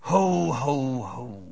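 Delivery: booming Santa laugh, dropping away at the end. Retold or imitated. Preacher to kindergartners, a mock Santa ho ho ho. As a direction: boom it out like a big Santa. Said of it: "His voice is gravelly and strong".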